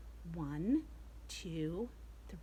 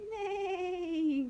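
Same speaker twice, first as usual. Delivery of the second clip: high, loud neigh; whinny like a pony; horse neigh; 11 semitones higher, 7 dB louder than usual. Shown as a demonstration. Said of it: "What's a horse say?".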